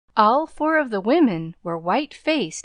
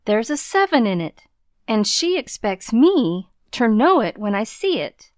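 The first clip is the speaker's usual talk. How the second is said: louder, sneering mimicry of "seven"; scornful street boy mocking the girl; 3 semitones higher, 6 dB louder than usual. A narrator's reading. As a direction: throw her words back at her, scornfully. Boy mocks her words.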